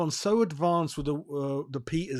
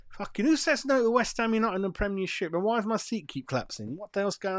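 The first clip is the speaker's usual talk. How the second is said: higher, worked-up outburst; getting too excited; 7 semitones higher than usual. Real, not acted. Ranting about Todibo to viewers, higher and worked up. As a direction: let the excitement run away with you. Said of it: "I'm getting too excited".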